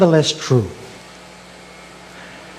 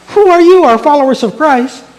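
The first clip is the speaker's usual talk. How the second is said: high, loud mock gunman demand; menacing challenge; 11 semitones higher, 4 dB louder than usual. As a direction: play an intruder interrogating the whole room. Imagined gunman challenging the congregation.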